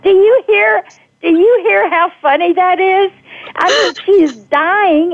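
grab the host, amazed at how funny it was; delighted. Real, not acted.

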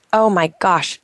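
usual speaking voice

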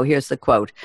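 ordinary talk, nothing marked

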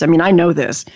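ordinary talk

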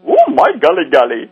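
put-on high, lilting Indian cab driver accent. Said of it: "I love that accent".

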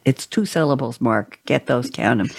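normal talking voice

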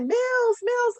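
high-pitched calling from the stands; calling out to son. Retold or imitated. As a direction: call the name up high, as if across a crowd.

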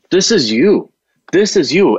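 husband pressing his wife, earnest and lifted; emphatic husband. Retold or imitated. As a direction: press it home with rising feeling. Husband's emotional insistence retold.

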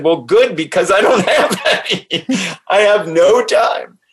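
retold reply broken by a laugh; amused urgency. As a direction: laugh through it, pitch climbing. Retells her reply, laughing.

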